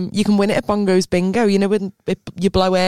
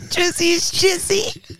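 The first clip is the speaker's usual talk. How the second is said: laughing; 9 semitones higher, much slower than usual. Real, not acted. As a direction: repeat it through a laugh, slowly. Laughing repeat of the phrase.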